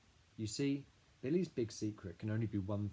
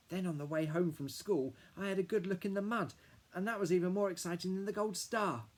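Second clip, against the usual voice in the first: put-on high, louder eager kid voice; excited little boy; 10 semitones higher, 5 dB louder than usual. A narrator's reading. Little brother Billy telling Dad about the mud, high eager kid voice. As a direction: gush like a small boy bursting with news.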